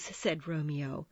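plain reading voice

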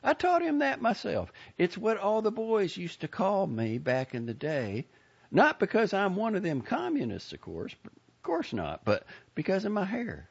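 put-on high, gushing old lady voice; a proud, fussy Southern lady; chatty old lady. A narrator's reading. Lady character voice.

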